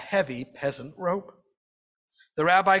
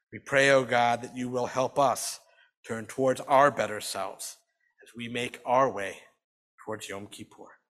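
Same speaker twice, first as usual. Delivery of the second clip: prayer; 6 semitones lower than usual. Acted. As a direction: pray gently for the congregation. Closing Sabbath prayer.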